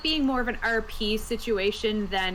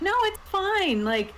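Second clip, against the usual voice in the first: higher-pitched mock reassurance; unconcerned players; 10 semitones higher than usual. Players brushing off a friend getting charmed, unconcerned. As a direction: voice the oblivious players, pitched up high. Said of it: "the other two were like".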